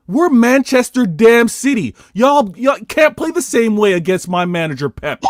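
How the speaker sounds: worked-up, high-pitched boasting; impassioned fan rant. Real, not acted. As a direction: declare it loudly for your club. Fan ranting about his club.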